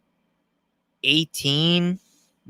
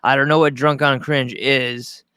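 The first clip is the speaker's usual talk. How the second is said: ordinary talk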